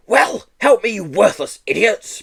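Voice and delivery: loud, breathless and strained; out of breath from struggling; panting. A narrator's reading. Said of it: "panted Miss Hatchett".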